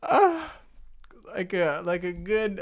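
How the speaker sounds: giggling through the words; can't stop laughing. Real, not acted. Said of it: "struggling to recall a word".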